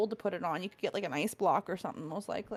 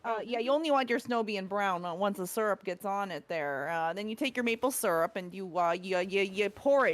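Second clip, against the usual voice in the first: put-on Canadian accent. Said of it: "can you say it in, like, a Canadian accent".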